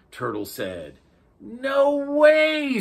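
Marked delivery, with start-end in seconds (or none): 1.4-2.8 high, loud disbelief; amazed octopus. A narrator's reading. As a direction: cry it out in wonder. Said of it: "said the octopus".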